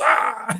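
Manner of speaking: high-pitched mock "aah"; imitated crowd cheer. Imitating everybody in a theater applauding, mock cheer. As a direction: cheer like a theater audience, half-hearted. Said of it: "everybody goes".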